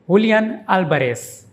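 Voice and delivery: Julian Alvarez as hoo-lee-AHN AL-ba-res in one breath; Spanish pronunciation. Shown as a demonstration. Teacher says the full name smoothly, the Spanish way. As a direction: Julian with h and last-syllable stress, Alvarez with b and s. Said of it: "is pronounced".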